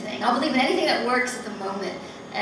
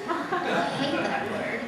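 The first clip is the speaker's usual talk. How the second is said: laughing, higher and amused; 6 semitones higher, slower than usual. Real, not acted. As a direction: say it through a chuckle. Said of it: "I actually hate the word".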